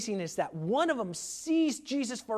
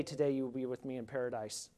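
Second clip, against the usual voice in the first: today you will be with me in paradise, almost whispered, much softer and lower, a bit quick; hushed awe; 8 semitones lower, 11 dB quieter, faster than usual. Real, not acted. Preacher marveling to the congregation, hushed. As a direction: breathe it out in quiet wonder. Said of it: "to see Jesus say that today".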